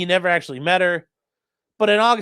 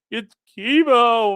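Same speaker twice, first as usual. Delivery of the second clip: slow, higher whiny voice; mock whiny insisting; 4 semitones higher, 4 dB louder, much slower than usual. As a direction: pout it out slowly. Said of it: "he'd be like".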